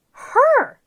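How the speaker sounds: a big storybook reveal; delighted reveal. A narrator's reading. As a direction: land it like a happy surprise. Reveal of moon's reflection.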